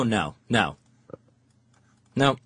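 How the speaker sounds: usual speaking voice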